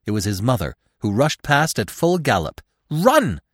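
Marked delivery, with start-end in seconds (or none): 2.9-3.4 high, loud cry to run; urgent cry. A narrator's reading. Mother urging her fawn to flee, urgent cry. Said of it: "she cried".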